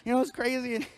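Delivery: flustered, stumbling delivery with nervous giggles; hammed-up nervous stammer; nervous stuttering. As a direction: fumble for a segue, repeating words. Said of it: "I'm stuttering".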